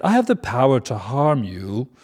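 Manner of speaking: retake of threat. Acted. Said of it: "That's a failed threat".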